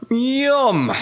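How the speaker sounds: high, long-drawn yum; relishing yum. A narrator's reading. Cherries and cream for Wilbur, high relishing yum.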